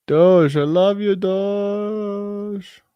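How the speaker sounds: high, loud sing-song affection; gushing over Doge. Real, not acted. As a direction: croon it like greeting a beloved pet. Greeting Dogecoin chart fondly.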